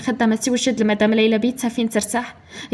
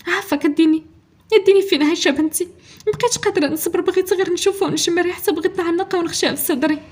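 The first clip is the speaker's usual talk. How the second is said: higher, pleading tremulous voice; trembling, raised voice; 6 semitones higher than usual. A narrator's reading. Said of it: "ليلى بصوت مرعود مرتفع نوعًا ما". (صوت مرعود مرتفع شوية، كيترجى)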